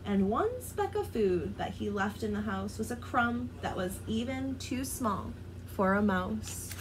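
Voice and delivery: plain reading voice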